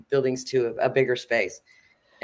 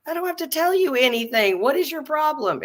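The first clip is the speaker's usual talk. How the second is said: raised mock argument; 11 semitones higher than usual. Tenant arguing with landlady.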